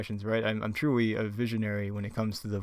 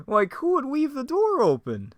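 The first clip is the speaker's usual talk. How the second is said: very high, louder cartoon squeak; Shaggy; 5 dB louder than usual. Shaggy puzzling over the open door, high put-on voice. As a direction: wonder aloud in a cracking falsetto. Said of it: "Shaggy's really thinking here".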